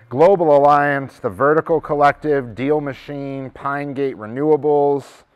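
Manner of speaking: projected roll-call of names; list announcer. Real, not acted. Host reading out a list of companies, announcing. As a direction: call out each company name with weight.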